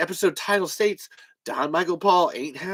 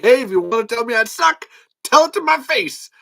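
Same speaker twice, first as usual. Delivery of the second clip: mock Don dare; 8 semitones higher, 7 dB louder than usual. Don daring him.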